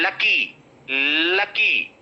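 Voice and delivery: "lucky" with a short la in the first syllable; correct pronunciation. Shown as a demonstration. Teacher models the next word, correct "lucky" with uh. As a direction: say "lucky" as luh-kee, no rounding. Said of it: "If you say law".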